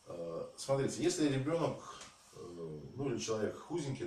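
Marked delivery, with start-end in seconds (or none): none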